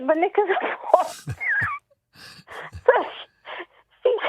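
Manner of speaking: high, laughter breaking into the words; helpless giggles while telling the story; cracking up. Real, not acted. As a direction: barely get the punchline out for laughing. Cracking up at her own punchline.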